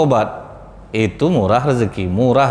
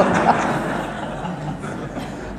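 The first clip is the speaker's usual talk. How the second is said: a breathless, voiceless chuckle; airy laugh; 5 semitones higher, 8 dB quieter than usual. Real, not acted. Preacher chuckling at the repentant man's luck. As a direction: laugh softly at the punchline.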